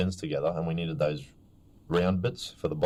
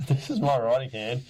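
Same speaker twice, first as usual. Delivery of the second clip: mock protest; 8 semitones higher than usual. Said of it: "I was like".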